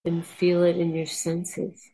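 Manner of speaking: soft, unhurried meditation voice; guided meditation. Acted. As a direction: lead the listeners calmly inward.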